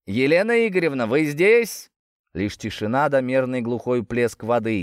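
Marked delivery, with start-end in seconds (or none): 0.0-1.9 calling out. Acted. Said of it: "прокричал Рыжков".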